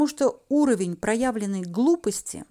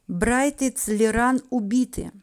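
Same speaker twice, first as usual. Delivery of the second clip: ordinary delivery, nothing marked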